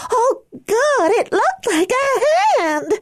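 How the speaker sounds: very high, sobbing panic; crying. A narrator's reading. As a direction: cry out in terror.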